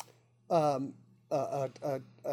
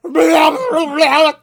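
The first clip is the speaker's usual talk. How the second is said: loud, high mock blast of a beginner band; imitated horn blare; 9 semitones higher, 9 dB louder than usual. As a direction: honk like kids blowing instruments badly. Imitates kids blowing trombones together.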